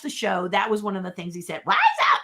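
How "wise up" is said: very high, squeaky 'Wise up' rising at the end; Baby Sinclair catchphrase. Retold or imitated. Baby sassing the CEO, high squeaky catchphrase. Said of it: "He would say that all the time".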